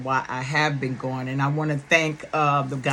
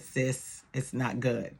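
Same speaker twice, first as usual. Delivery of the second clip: quiet, lower, heavy news; soft grave news; 4 semitones lower, 10 dB quieter, slower than usual. Retold or imitated. Brother on the phone telling sister quietly that it's bad news. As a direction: break the bad news gently. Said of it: "he called me and he said".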